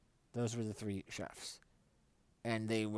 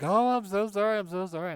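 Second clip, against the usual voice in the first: higher, louder mock-flustered mom; flustered apology; 11 semitones higher, 11 dB louder than usual. Mom apologizing to everyone at the table, high flustered voice. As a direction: gush apologies as the embarrassed mom. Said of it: "she was like".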